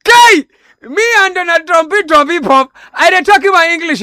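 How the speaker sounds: loud, high-pitched indignant protest; indignant outburst. Acted. Host defending his English to co-host, loud indignant outburst. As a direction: explode in mock offence, loud and high. Bristles at co-host's interruption.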